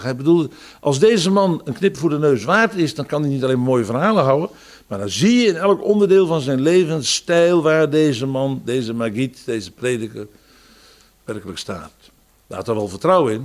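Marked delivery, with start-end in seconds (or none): none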